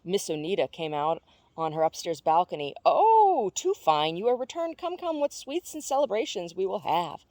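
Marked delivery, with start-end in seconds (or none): 2.8-7.2 high, loud gushing welcome; overjoyed neighbour greeting; joyful. A narrator's reading. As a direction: squeal with delight at their return. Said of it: "clapping her hands for joy".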